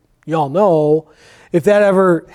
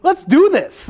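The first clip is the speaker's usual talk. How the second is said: higher-pitched mock enthusiasm, rising to the end; eager agreement; 8 semitones higher than usual. Firefighter imitating a won-over patient, eagerly agreeing. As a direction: voice a suddenly willing convert.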